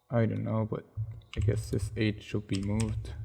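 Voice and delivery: normal talking voice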